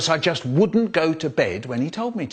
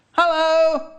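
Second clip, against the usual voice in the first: high calling mum voice; 4 dB louder than usual. Retold or imitated. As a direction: call up the stairs high. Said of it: "My mum calls out".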